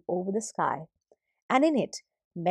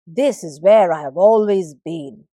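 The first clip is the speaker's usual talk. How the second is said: declared; 3 semitones lower, 9 dB louder than usual. Acted. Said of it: "declared the sky".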